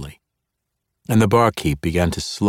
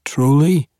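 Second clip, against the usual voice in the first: a high, louder mocking "Truly?"; taunting; 8 semitones higher, 4 dB louder than usual. Weapon master goads the frightened barkeep, taunting. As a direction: taunt him, daring him to fight. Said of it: "Zaknafein taunted".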